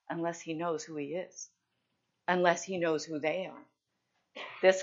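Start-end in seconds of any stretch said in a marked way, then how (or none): none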